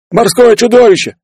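loud, very high cry of alarm; panicked shout. A narrator's reading. Running sailor announces the monster, panicked shout. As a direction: yell it out in terror. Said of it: "крикнул пробегавший мимо матрос".

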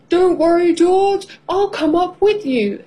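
high-pitched, soothing parent voice; reassuring father. A narrator's reading. Father reassures crying child.

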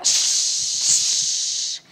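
loud cat hissing. Acted. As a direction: hiss like an angry cat. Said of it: "зашипела".

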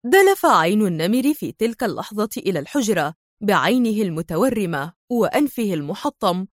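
ordinary delivery, nothing marked

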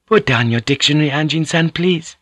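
a courteous lady explaining sweetly; Mariko, gently teasing. Acted. Narrator voices Mariko.

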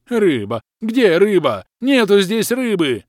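high, loud creature wail. Acted. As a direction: howl it back in denial. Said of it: "завопил в ответ Лохр".

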